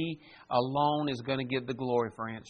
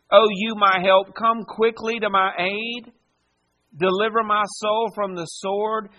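impassioned prayer; 3 semitones higher, 5 dB louder than usual. Acted. Preacher reading David's prayer.

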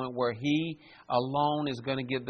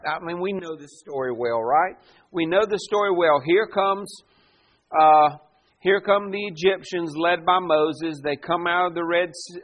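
ordinary talk

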